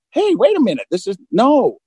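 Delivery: mock alarm. Man catching himself holding a twelve-pack, mock-startled protest. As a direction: catch yourself with a startled objection.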